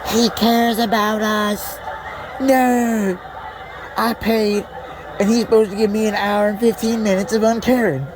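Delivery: very high, put-on complainer voice; mock whining audience member. Audience member griping about what they paid for, high mock whine. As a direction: squeal it like an aggrieved ticket holder.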